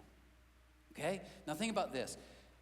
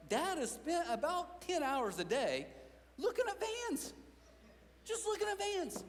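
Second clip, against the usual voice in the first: put-on much higher, slow teasing kid voice; mocking kids; 12 semitones higher than usual. Kids teasing their dad, high mocking voice. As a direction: drag out the tease about the vans. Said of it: "my kids have made fun".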